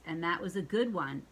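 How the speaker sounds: ordinary delivery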